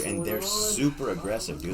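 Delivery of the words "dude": usual speaking voice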